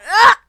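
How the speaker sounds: short, high, loud yelp; startled scream. Real, not acted. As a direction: yelp suddenly in surprise. Startled by pressing wrong buttons.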